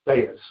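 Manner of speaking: "Theos" said as THAY-us; incorrect pronunciation. Shown as a demonstration. Preacher showing what is not correct, "Theos" as THAY-us. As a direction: say "Theos" with AY after the TH. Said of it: "That is not correct to say".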